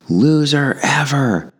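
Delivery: put-on high, slow teen voice jeering; teen taunt. High school kid jeering at jogger, high mocking voice.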